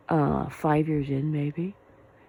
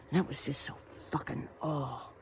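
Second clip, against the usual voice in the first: disgusted mutter; 8 dB quieter than usual. Real, not acted. Disgust trailing off.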